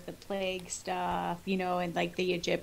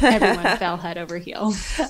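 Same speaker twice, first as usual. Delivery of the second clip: laughing; 8 dB louder than usual. Real, not acted. Laughing while reading a line.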